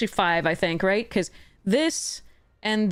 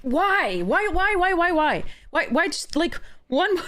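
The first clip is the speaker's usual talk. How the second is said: much higher, pleading string of whys; whiny repeated why; 8 semitones higher, slower than usual. Real, not acted. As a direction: whine at the game in disbelief. Repeated whys at bad draw.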